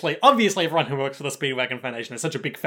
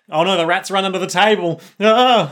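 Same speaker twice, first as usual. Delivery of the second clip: loud, higher, panicked yelp at the end; mock panic; 5 semitones higher, 7 dB louder than usual. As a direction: play up the alarm, ending in a cry. Acting out a panicked shooter.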